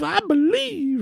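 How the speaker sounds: put-on very high, slow childlike voice; inner child. Dad channeling his inner child at the casino, high slow kid voice. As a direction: say it like a wide-eyed kid. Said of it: "channel my inner child".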